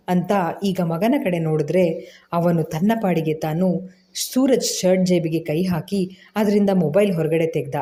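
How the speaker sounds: plain reading voice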